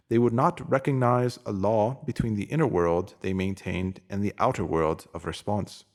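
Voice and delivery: normal talking voice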